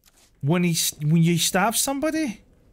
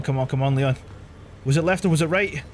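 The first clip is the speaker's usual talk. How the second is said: usual speaking voice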